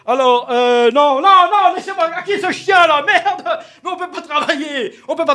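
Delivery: high, loud flustered yelling; loud frantic outburst; exasperated shout. Acted. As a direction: shoo the dog away in a panic. Interrupted by dog, shouting.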